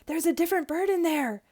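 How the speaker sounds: high, loud excited stage-whisper; excited and forceful, high. A narrator's reading. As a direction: urgently point out a discovery, pitched high. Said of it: "I whispered loudly".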